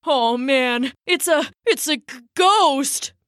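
stammering scared tree frog; teeth chattering. A narrator's reading. Tree frog stammering at the rising spirit, scared. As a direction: chatter through the fear. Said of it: "chattered the tree frog".

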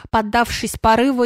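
usual speaking voice

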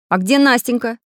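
worried. A narrator's reading. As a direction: ask with sudden concern. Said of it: "забеспокоился Самоделкин".

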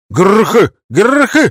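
high, loud defiant grunts; loud battle cry. Acted. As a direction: bark it back at the enemy. Answering the hunters' cries.

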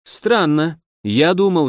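plain reading voice

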